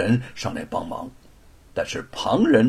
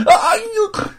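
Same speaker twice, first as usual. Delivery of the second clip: very high, loud yelp of terror; terrified cry; 6 dB louder than usual. Acted. Young master crying out as the stele flies at him, terrified. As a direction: shriek as the stone falls on him. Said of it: "罗庞惊恐地大叫了一声".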